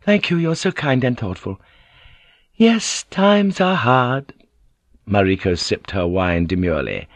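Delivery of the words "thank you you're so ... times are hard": Gyoko sighing. A narrator's reading. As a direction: sigh over hard times with false grace.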